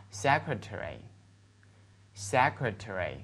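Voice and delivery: "secretary" said slowly as a model, all syllables; read-along model. Shown as a demonstration. Teacher asks students to read along, slow clear model. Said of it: "跟我读一下嘛".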